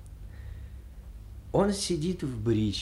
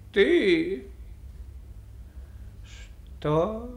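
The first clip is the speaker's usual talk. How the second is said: slow, higher questioning voice; slow drowsy coachman; 6 semitones higher than usual. A narrator's reading. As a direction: draw the words out like a dozing driver. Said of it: "сказал Селифан медленным голосом". (медленный голос сонного кучера)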